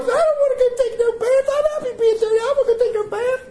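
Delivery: put-on very high, whining kid voice; whiny child. The boys whining back at their father about having to take a bath. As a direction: whine like a kid refusing a bath.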